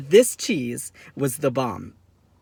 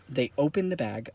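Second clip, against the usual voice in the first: ordinary talk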